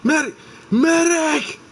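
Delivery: high, loud yelled call; man down shout. Pretend. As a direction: scream for a medic. Calling out for a medic.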